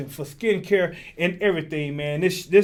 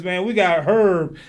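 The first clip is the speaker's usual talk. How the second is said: ordinary talk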